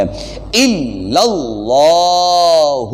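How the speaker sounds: الا اللہ with the doubled لام pressed and the second لام drawn long; slow recitation. Shown as a demonstration. Teacher chants الا اللہ slowly for students. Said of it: "کھڑی زبر آتی ہے اس کو تھوڑا سا لمبا کرنا".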